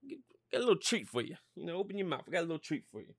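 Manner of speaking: soft, stammering sweet-talk; seductive coaxing. Acted. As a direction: lure her over, hushed and teasing. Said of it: "Just tell her, like".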